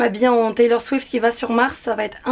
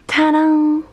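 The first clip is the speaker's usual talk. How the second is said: showy reveal; 5 semitones higher, 5 dB louder than usual. Real, not acted. Présente sa tenue.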